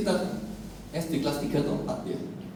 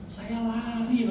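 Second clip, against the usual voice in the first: ordinary talk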